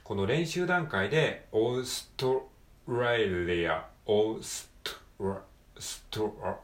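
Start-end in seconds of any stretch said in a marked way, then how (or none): 1.5-6.6 slow it down and chunk the str; slow cluster drill. Shown as a demonstration. Teacher slowly chunking the cluster for learners. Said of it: "ストアって感じで".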